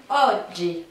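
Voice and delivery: oggi with a long accented O and a D-like start to the G; stress on first syllable. Shown as a demonstration. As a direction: hit the O of oggi, then a firm "dji". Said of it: "on accentue plus sur la".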